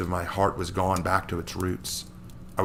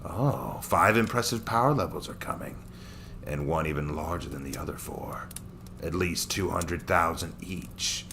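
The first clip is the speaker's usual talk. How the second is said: a cruel Saiyan amused by his prey; sneering villain; 3 semitones lower, 5 dB quieter than usual. Acted. Daikon reading his scouter.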